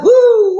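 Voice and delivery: high, loud "woo"; piercingly high cheer; whoop. Real, not acted. Whoop ending the angel song.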